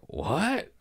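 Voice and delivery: high, rising incredulous question; stunned disbelief. Retold or imitated. Siblings hearing their father will come down, incredulous. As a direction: blurt a high-pitched, baffled reaction.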